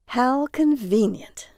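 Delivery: slow, dry sarcastic drawl; slow sarcastic remark. Acted. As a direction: drag it out with mock gratitude. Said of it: "Jaron said sarcastically".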